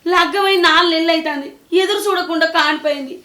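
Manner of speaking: loud, high, indignant tirade; outraged protest. Acted. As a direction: protest in shock and anger. Mother protests son-in-law's demand.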